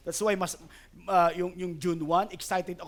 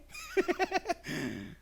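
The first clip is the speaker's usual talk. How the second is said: high laugh; 13 semitones higher than usual. Real, not acted. Laughs after quoted "Ah, okay.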